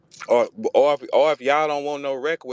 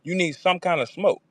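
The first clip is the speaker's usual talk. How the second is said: normal talking voice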